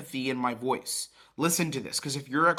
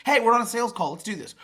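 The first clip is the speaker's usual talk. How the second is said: much higher and louder, pushy; overeager salesman; 9 semitones higher, 7 dB louder than usual. Shown as a demonstration. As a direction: rush him straight into the pitch.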